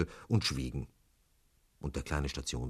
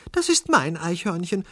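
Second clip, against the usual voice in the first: put-on high, raised-voice young lady; 6 dB louder than usual. A narrator's reading. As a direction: claim it in a high feminine voice. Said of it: "sagte sie".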